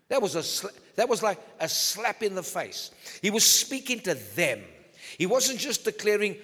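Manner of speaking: ordinary talk, nothing marked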